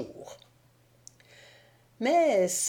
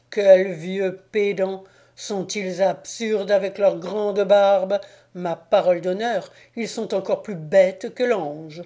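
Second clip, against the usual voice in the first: furious rant; 5 dB louder than usual. Acted. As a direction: rage at the departing saints. Enraged devil insults the saints. (le diable enragé insulte les saints)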